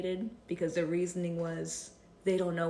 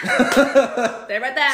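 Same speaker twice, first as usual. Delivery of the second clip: laughing; 6 semitones higher, 12 dB louder, much slower than usual. Retold or imitated. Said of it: "Stay there".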